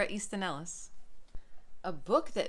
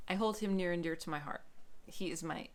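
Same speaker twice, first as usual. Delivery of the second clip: normal talking voice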